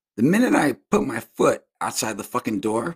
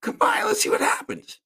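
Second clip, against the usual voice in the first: taunting dare. Retold or imitated. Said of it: "I let him know".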